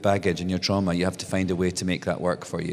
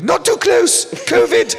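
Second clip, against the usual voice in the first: much higher, loud bossy warning; Miriam's sharp warning; 8 dB louder, slower than usual. Retold or imitated. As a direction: bark at the kids to keep away.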